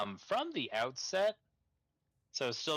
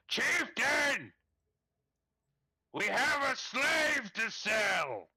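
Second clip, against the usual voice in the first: loud high call across the camp; shouting announcement; 12 semitones higher, 5 dB louder, much slower than usual. Acted. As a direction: bellow it out to the chieftain. NPC announces to chieftain.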